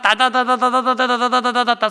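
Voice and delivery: slow rattling machine gun imitation; spray it like a gun; machine gun noise. (기관총 소리 흉내)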